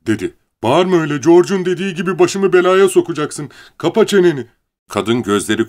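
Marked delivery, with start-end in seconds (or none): 0.6-4.5 high, loud, agitated scolding; angry outburst. A narrator's reading. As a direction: shout her down in a panic. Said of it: "Bunun üzerine Lenny kızdı". (öfkeli, telaşlı bağırış)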